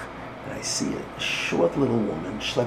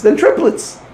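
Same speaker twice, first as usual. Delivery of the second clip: excited; 10 dB louder, slower than usual. Retold or imitated. Woman tells her children.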